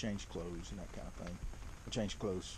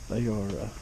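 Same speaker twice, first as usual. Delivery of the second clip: ordinary delivery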